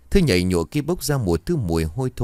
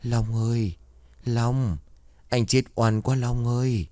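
mournful, higher-pitched ghostly plea; tearful wail; 3 semitones higher, slower than usual. A narrator's reading. Drowned man weeping at sleeping friend's bedside, mildly tearful wail. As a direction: call out plaintively, as if weeping. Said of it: "mếu máo khóc".

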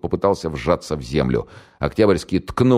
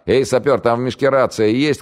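higher, louder call into the dark; крик; 9 semitones higher, 4 dB louder than usual. Acted. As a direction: shout across to the sapper. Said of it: "крикнул Октябрьский". (окрик через темноту)

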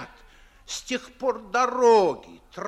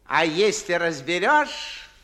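usual speaking voice

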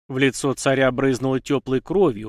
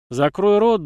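angry order; 7 semitones higher, 6 dB louder, slower than usual. A narrator's reading. Anna bound and threatened.

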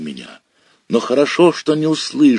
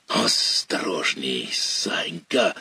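stammering; 2 semitones lower, 6 dB quieter, slower than usual. Acted. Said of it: "начал я заикаться".

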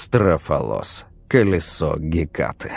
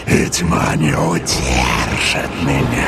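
lower, hoarse defiant cry; hoarse shout; 5 semitones lower than usual. A narrator's reading. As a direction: rasp it out, stressing не. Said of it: "хриплым голосом".

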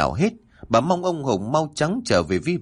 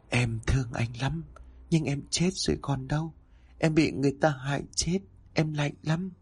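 much softer, otherworldly echo by his ear; soft ghostly voice; 4 semitones higher, 7 dB quieter than usual. A narrator's reading. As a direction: speak like a ghost from another world. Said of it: "một giọng nói khẽ vang lên bên tai, âm vang như từ thế giới khác vọng lại".

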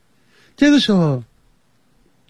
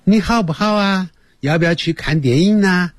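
light Sichuan-dialect greeting, higher; a hint of a Sichuan friend asking; 7 semitones higher than usual. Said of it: "听起来有点像四川话讲的".

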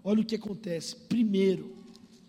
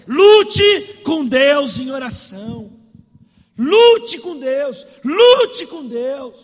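full-force preaching; 2 semitones higher, 14 dB louder than usual. Real, not acted. Pastor urging believers to fight in prayer, forceful preaching. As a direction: hammer the commands out to the congregation.

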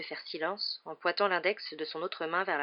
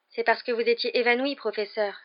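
put-on higher, earnest girl's correction; grave; 6 semitones higher, 4 dB louder than usual. A narrator's reading. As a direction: state it gravely. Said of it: "dit gravement Hermione". (dit gravement)

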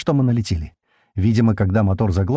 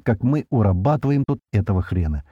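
ordinary delivery, nothing marked

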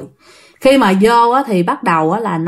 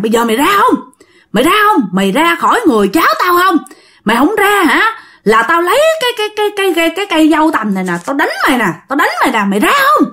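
raised, rapid-fire angry warnings; threatening exorcist; 7 semitones higher, much faster than usual. Acted. Uncle threatens the spirit with a branch, high urgent warning. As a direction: order the spirit out, pitch climbing.

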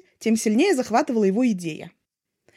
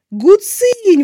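loud, higher excited exclamation; exclaiming; 8 semitones higher, 9 dB louder than usual. A narrator's reading. Wei Ying hears Lanzhan plays guqin, loud exclamation. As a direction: cry out the word in delight. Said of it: "воскликнул Вэйин".

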